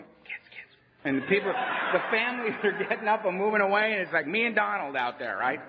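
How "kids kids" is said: imitating an alarmed parent's whisper; hushed warning. Parents at hamburger stand gathering their children, hushed warning. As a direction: whisper to the children to come away.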